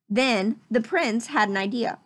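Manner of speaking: ordinary delivery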